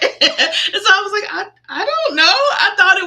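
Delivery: laughing confusion. Real, not acted. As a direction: still laughing, high and loud. Laughing, flustered retelling.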